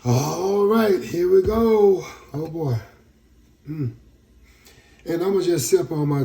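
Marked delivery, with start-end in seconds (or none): 0.1-2.1 loud, slow psyching up; psyched up. Real, not acted. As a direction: pump yourself up before something gross. Psyching up to drink castor oil.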